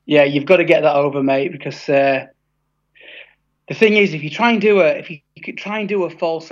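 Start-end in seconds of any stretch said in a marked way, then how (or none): none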